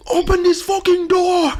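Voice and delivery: high, loud retold shout; big-voiced yell. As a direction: yell it like a furious man outside. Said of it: "a big voice".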